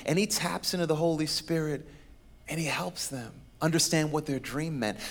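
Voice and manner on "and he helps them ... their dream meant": ordinary delivery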